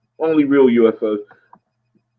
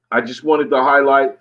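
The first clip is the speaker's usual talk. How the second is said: ordinary talk, nothing marked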